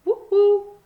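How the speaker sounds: high loud hooting call; eerie high woo-hoo; owl hoot. A narrator's reading. Strange owl hoot in woods.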